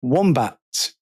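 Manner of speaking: usual speaking voice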